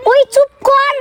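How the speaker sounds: quick high call to shut up; sharp hush. Real, not acted. Vlogger cuts off a friend mid-take, high and sharp.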